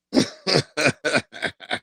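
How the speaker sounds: long run of chuckles; laughing. Real, not acted. Man cracking up at his balls joke, laughing. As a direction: laugh it out in a quick string.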